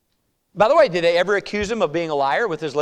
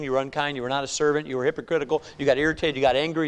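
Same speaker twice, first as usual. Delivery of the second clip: ordinary talk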